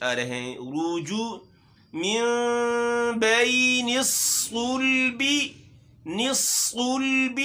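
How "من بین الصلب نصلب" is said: slow chanted recitation with a heavy صاد; tajweed recitation. Shown as a demonstration. Teacher demonstrates the verse for children, slow chant. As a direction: draw it out, make the صاد thick. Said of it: "موٹا حرف ہے صاد".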